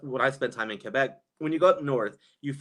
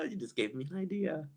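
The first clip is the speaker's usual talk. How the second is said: usual speaking voice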